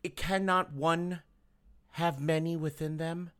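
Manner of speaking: very low, hushed oracle voice; mock-solemn pronouncement. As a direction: intone it low and soft, with pauses.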